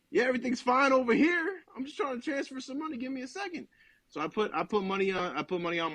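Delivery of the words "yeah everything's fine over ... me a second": high, over-bright reassurance; high-pitched forced calm; nervously cheerful. Retold or imitated. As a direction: sound fine while panicking inside. Nervous reply to stranger at pump.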